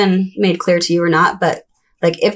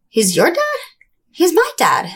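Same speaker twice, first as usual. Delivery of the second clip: put-on higher, puzzled kid voice; imitating the siblings; 10 semitones higher, slower than usual. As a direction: answer back like a surprised child. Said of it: "they're like".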